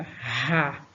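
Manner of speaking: "ra" with a forceful h-like R; "ra" as a strong aspirated syllable. Shown as a demonstration. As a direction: push the air out hard on "ra". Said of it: "a força pra pronunciar a sílaba".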